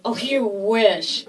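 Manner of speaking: mocking brush-off. Woman rebuffing Spike Lee's line, slow mocking retort. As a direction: shoot him down slowly, with relish.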